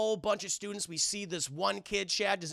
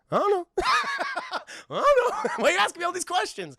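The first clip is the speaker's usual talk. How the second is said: very high, loud laughing voice; silly giggling character; 8 dB louder than usual. Retold or imitated. Clueless character dodges questions, high silly laughing voice. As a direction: giggle through it in a put-on voice.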